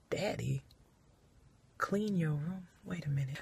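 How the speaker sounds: hushed wait-a-minute; dawning whisper. Acted. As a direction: whisper it as the penny drops. Realizing aside, whispered.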